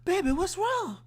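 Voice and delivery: high, loud motherly voice; worried mama. Retold or imitated. Mama asking her daughter what's wrong, high and worried. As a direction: play the mom fretting over her daughter. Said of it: "The mama like".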